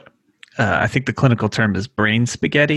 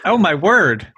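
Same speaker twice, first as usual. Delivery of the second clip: surprised; 7 semitones higher, 6 dB louder than usual. Real, not acted. Reacting to hidden fourth speaker.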